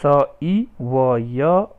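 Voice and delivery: each letter sounded out slowly, one by one; syllable by syllable, unhurried; slow spelling out. Shown as a demonstration. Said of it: "वैसे ही सिब्यति का".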